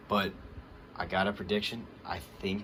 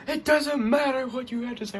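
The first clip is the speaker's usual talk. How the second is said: higher, louder mock taunt; mock Austin Theory; 6 dB louder than usual. Vlogger quoting Austin Theory's comeback, mock taunt. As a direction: throw the line back like a cocky heel.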